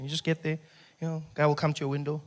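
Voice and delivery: ordinary delivery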